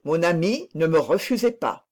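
plain reading voice

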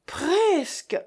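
high, falling sigh of dismay; dismayed, high-pitched echo. A narrator's reading. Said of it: "ressoupira monsieur Hilaire".

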